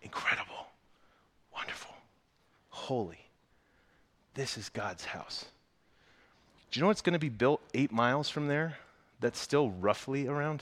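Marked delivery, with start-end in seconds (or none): 0.0-5.5 hushed awed whisper; fearful awe. A narrator's reading. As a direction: breathe it out in trembling awe. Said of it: "He whispered in awe".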